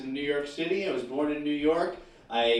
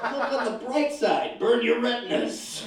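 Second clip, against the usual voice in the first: sarcastic; 8 semitones higher than usual. Acted. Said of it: "terms about the weather".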